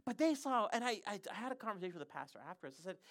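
ordinary talk, nothing marked

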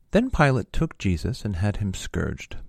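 usual speaking voice

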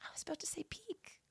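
soft unvoiced whisper. Real, not acted. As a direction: breathe it as a whisper. Quiet aside to co-host.

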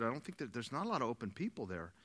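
low, soft, deflated admission; discouraged. Retold or imitated. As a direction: imitate someone quietly losing heart. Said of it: "he came and he was discouraged".

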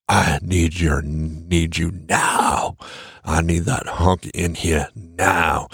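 low, slow, aching mock plea; mock lustful plea. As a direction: play the woman begging slowly in a low voice. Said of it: "it's gonna be like".

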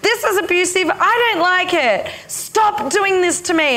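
high angry tirade, wounded; furious inner feminine. Retold or imitated. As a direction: rage at the spiritual teacher. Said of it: "she was furious with me".

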